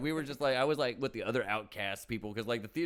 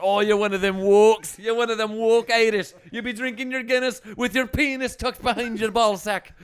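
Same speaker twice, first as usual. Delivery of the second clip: louder, higher mocking Irish brogue; Irish accent, mocking; 8 semitones higher, 10 dB louder than usual. Irishman mocks his progressive rival, loud mocking Irish accent. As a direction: jeer at the woke Irishman in a hammed-up brogue. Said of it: "Irish arguing booze".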